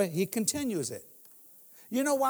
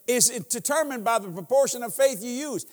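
usual speaking voice

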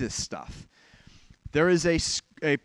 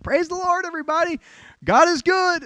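high, loud churchy call-out; imitating an enthusiastic woman leading a church call-and-response; excited church lady; 13 semitones higher, 8 dB louder than usual. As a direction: belt it out like an eager lady at testimony time. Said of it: "she's like".

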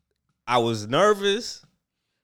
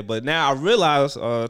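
ordinary delivery

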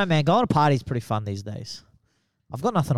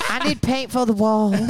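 [name]; 7 semitones higher than usual. Acted. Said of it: "do [name] Goes to Bunnings".

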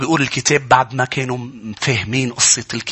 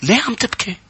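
astonished question; 8 semitones higher than usual. Acted. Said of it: "كان سؤال تعجب".

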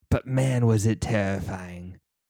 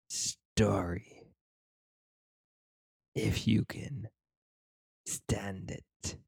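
horror host. Acted. Spooky storyteller invitation, fading.

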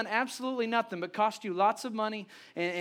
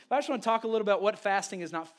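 ordinary talk, nothing marked

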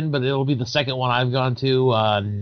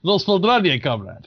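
put-on Russian accent; 4 semitones higher than usual. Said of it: "Russian accent".

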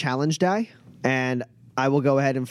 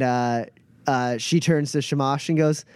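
ordinary delivery

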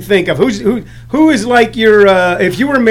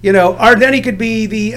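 ordinary delivery

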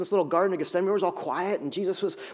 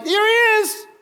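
high, loud mock call pointing him out; betrayer calling out; 5 dB louder, slower than usual. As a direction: sing it out like exposing someone in the crowd.

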